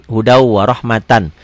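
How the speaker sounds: slow "hudau warohmatan" with the final -tan sounded; full ending. Shown as a demonstration. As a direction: join "hudau" into a held w, pronounce "warohmatan" to its last n. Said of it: "dibaca apa adanya". ("hudau warohmatan", akhiran tan dibaca penuh)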